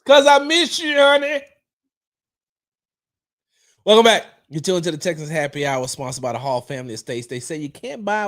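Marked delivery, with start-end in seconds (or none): 0.0-1.4 mock tender. Host answers wife's complaint, high mock tender. 3.8-4.2 loud welcome. Real, not acted. Host greets audience again.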